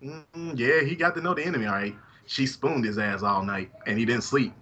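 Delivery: ordinary delivery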